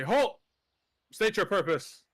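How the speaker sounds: loud gruff halt from a bear guard; sudden guard call to stop; guard shout. Acted. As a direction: bark the challenge at the door. Bear guard challenges party.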